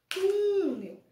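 túnel with TU loud, high and held; stress on first syllable. Shown as a demonstration. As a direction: punch and hold TU in túnel, then drop. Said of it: "a gente achou a sílaba tônica".

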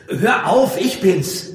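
high, loud startled shout; urgent cry of protest. A narrator's reading. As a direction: yell to stop the attack. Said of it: "rief er".

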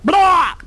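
loud retching blah; mock barfing shout. Showing the kid a seasick passenger barfing over the edge. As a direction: hurl it out like seasick. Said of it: "they're just like".

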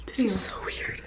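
awed whisper, breath only. Real, not acted. Walking into the new apartment, whispering in awe. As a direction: hush it like you can't believe it.

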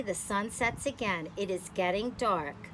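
ordinary delivery

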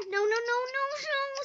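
real panicked repeated no; panicked screaming. Real, not acted. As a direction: beg it to stop, in real panic. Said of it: "Sorry for screaming!".

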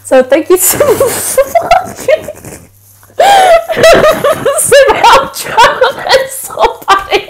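giggling through the outro; can't keep a straight face, laughing; breaking character giggling. Real, not acted. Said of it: "I can't do it with a straight face".

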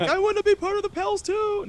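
high, whiny mock yell; imitated background yelling. Imitating Cody heard yelling over Skype, high mock yell. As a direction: mimic a player calling out in a high voice. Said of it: "I hear Cody yelling in the background".

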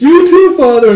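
very high, loud burst to father; excited farewell call. Retold or imitated.

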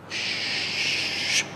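soft breathy shush to quiet the room; teacher hushing noisy students, soft shush. Real, not acted. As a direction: hush everyone softly. Hushing the room mid-class.

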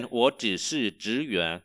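very slow syllable-by-syllable Chinese; slow teacher demo; very slow model reading. Shown as a demonstration. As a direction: spell it out slowly for learners. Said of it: "Tôi chỉ là nhân viên".

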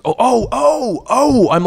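high, drawn-out ohs; exaggerated retold "oh, I get it"; sudden realization. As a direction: act out the penny dropping, big and high. Retold realization of his mistake.

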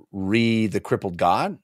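usual speaking voice

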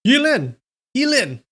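high loud call from outside; shouting a name. A narrator's reading. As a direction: call her name from afar. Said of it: "Someone shouted outside".

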